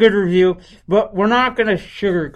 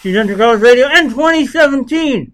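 slurred like a mouth full of retainer; a goofy put-on lisp through a retainer; 6 semitones higher, 6 dB louder than usual. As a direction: mumble it like a teen with a new retainer, louder and higher. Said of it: "I sound like I'm in high school and I have some weird retainer in my mouth".

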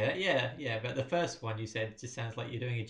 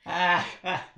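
laughing; 4 semitones higher, 5 dB louder than usual. Real, not acted. Laughing at own joke.

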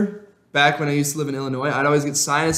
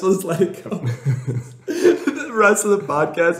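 talking through giggles; laughing; 4 semitones higher than usual. Real, not acted. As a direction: laugh while you say it. Joking they'll pass out mid-podcast.

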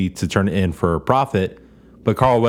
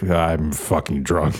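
put-on low, slow, slurred drunk voice; mock drunk; 6 semitones lower, slower than usual. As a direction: drag it out like a sloshed old man. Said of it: "Nick Nolte's like".

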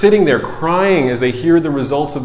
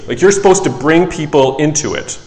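normal talking voice

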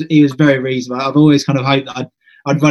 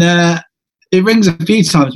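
normal talking voice

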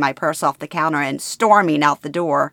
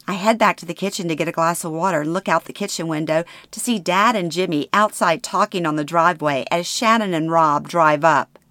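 ordinary delivery

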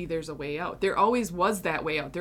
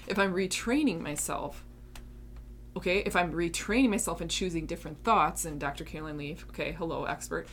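usual speaking voice

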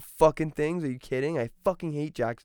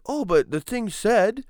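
grandma's excuse; 10 semitones higher, 6 dB louder, slower than usual. Retold or imitated. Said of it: "she's like".

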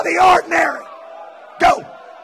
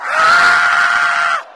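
high, loud held cry; loud shout; 14 semitones higher, 3 dB louder than usual. Real, not acted. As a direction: let out one long shout.

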